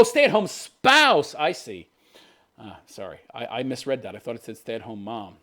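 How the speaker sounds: normal talking voice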